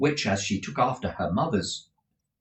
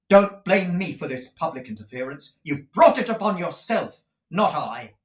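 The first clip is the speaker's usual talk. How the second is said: higher, louder indignant rebuke; angry rebuke; 7 semitones higher, 7 dB louder than usual. A narrator's reading. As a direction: throw the blame back. Nicholas accuses Squeers.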